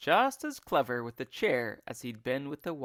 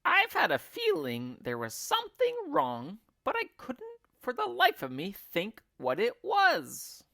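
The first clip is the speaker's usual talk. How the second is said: put-on high old woman's voice, louder; high fussy crone, fretting; Missus Twit; 11 semitones higher, 4 dB louder than usual. A narrator's reading. Wife puzzling over her stick in a high crone voice. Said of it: "Missus Twit".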